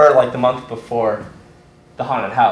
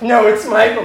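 raised pitch, playful contradiction; insistent correction; 7 semitones higher than usual. Retold or imitated. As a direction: overrule them, voice up. In character, insisting on the name.

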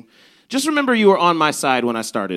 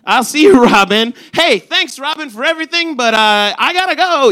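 high, breezy goodbye; breezy brush-off; 10 semitones higher, 6 dB louder than usual. Retold or imitated. Brushing off crying friend Robin, breezy and high. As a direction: chirp a quick exit to a crying friend.

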